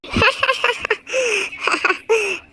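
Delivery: scornful laugh; mocking laugh. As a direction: laugh her off. Boss laughs at confession. (मज़ाक उड़ाती हँसी)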